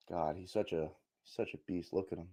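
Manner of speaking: quiet, reverent murmur; almost to himself, in awe of a legend; soft, reverent hush. Real, not acted.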